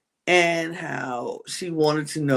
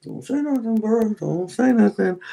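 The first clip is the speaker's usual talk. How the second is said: mock silent warning; 4 semitones higher than usual. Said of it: "giving a look like".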